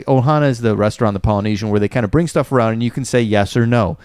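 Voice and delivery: ordinary talk, nothing marked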